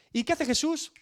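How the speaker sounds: normal talking voice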